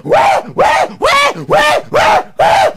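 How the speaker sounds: very high, loud rhythmic wails; repeated yelling. A narrator's reading. Anansi beaten by the whipping cord, yelling. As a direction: yelp again and again in comic agony. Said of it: "Anansi was yelling".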